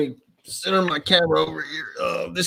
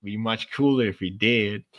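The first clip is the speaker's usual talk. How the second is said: Matthew McConaughey; 4 semitones lower, 4 dB quieter than usual. Acted. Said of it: "is he speaking about Matthew McConaughey?".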